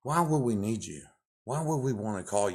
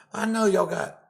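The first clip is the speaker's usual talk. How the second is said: sudden outburst; 11 semitones higher, 7 dB louder than usual. Real, not acted. Angry rant at caseworker.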